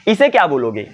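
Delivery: ordinary talk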